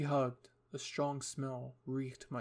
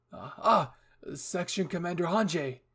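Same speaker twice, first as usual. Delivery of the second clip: startled, stammering address, louder; surprised; 4 semitones higher, 8 dB louder than usual. Acted. Eren greeting Hanji unexpectedly, surprised stammer. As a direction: stumble into the greeting, caught off guard. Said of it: "He was just as surprised".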